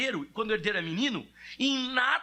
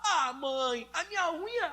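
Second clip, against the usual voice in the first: put-on higher, whining little boy; whiny son; 6 semitones higher, slower than usual. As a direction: complain to mom like a small child. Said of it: "o Felipe lá". (filho choramingando para a mãe, agudo)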